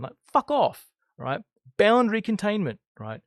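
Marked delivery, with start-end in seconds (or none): 0.3-0.9 angry outburst. Acted. When you get angry at someone.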